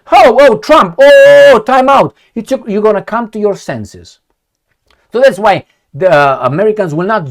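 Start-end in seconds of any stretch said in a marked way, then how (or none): 0.1-2.1 high, loud, panicky pleading; mock panic. The West begging Trump in a mock panic. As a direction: beg for a time out in a mock panic. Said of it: "You're gonna say".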